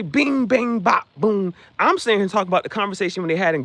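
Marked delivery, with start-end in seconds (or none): 0.0-1.5 high, loud punchy sound-effect words; punchy bing bang bop. Retold or imitated. Explaining his Goodfellas shirt, punchy bing bang bop. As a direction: hit each word like a movie punch. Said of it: "But if I say".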